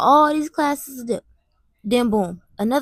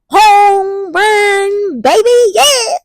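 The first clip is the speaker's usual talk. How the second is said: much higher, much louder victory shout; hype celebration; 8 semitones higher, 8 dB louder, much slower than usual. Pretend. Cheering herself for finishing classes, loud victory yell. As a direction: yell it like you just hit a home run.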